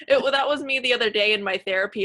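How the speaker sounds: ordinary talk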